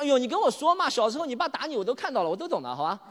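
a hint of a pushy buddy coaxing; coaxing friend. Friend coaxing him to talk. (略带夸张地扮朋友劝说)

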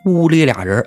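plain reading voice

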